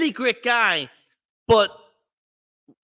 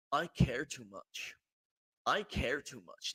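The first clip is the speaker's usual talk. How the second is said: 1 mocking Jericho; 6 semitones lower, 11 dB quieter than usual.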